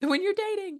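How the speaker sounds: very high, squeaky mock-tearful voice; high retold weepy squeal; imitating tearful friend. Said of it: "she started crying".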